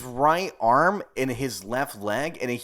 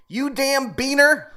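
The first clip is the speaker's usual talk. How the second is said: higher, louder, slow stretched mock yell; mock road rage; 10 semitones higher, 6 dB louder, slower than usual. Imitating a driver yelling at another car, hammed up. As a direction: ham up a driver's insult. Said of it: "and you say, like".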